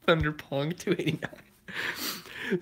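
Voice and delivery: laughing. Real, not acted. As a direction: try to say it while cracking up. Still cracking up at the nickname.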